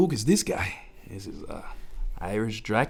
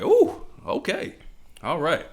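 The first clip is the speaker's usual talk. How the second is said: taken aback; 5 semitones higher, slower than usual. Retold or imitated. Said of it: "I was like".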